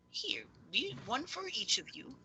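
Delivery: high, soft character voice; high-pitched, softly spoken figure; game master's character voice. Acted. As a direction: hand it over in a high, gentle voice.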